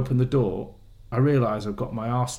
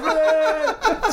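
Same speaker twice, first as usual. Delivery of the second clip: mock cheer.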